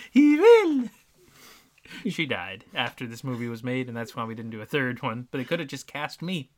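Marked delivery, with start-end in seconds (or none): 0.0-0.8 very high, slow, louder put-on accent; overdone falsetto, stretching each word; Bianca impression. Bianca insisting on rescue, in hammed-up high accent. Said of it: "I'm not sure what accent that is".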